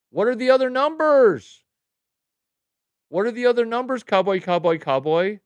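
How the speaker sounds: high, loud exasperated shout; demanding yell. Real, not acted. Streamer yelling at viewer Cowboy for his numbers, exasperated shout. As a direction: yell the question at him, fed up.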